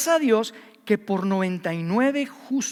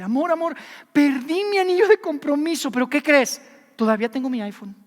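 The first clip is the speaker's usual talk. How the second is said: put-on a higher, sweetly careless girlfriend voice; girlfriend on the phone; 6 semitones higher, 5 dB louder than usual. Preacher mimics a fiancée calling about her lost ring, higher and sweetly casual. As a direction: play the fiancée breezily brushing off the lost ring. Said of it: "te llama tu novia y te dice".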